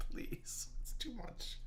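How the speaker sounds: barely voiced, all laughed out; spent whisper. Real, not acted. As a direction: whisper weakly after a laughing fit. Worn out after laughing fit.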